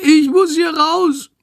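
very high, tearful, drawn-out wail; desperate wail. A narrator's reading. Sick bat trapped on the plane, wailing desperately. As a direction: plead high and slow, near tears.

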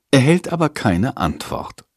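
ordinary delivery

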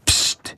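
quiet unvoiced psst; soft hiss. Bat Chandor hushing Jendrik with a soft hissed psst. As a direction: hush the boy with a soft hiss. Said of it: "zischt Chandor leise".

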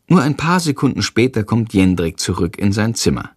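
ordinary delivery

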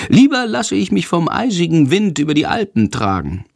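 high little bat voice, still sulking; grumbling bat. A narrator's reading. Little bat Sándor sulking about flying home. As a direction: sulk on in a squeaky bat voice. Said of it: "schimpft er".

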